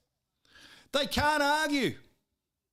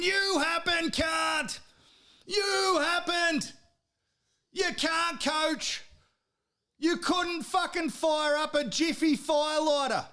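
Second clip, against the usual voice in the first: furious rant; 6 semitones higher, slower than usual. Real, not acted. Fan yelling at the Origin coach, furious shout. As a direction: spit it out like an enraged fan.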